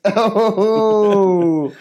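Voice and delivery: loud, high drawn-out ooh; gleeful crowd-style oooh; long high "ooh". Real, not acted. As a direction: react to a savage put-down. Mock reaction to teacher's put-down.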